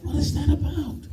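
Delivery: high, puzzled what-is-that; confused complaint. Retold or imitated. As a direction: sound baffled you can't hear. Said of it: "sitting across the table going".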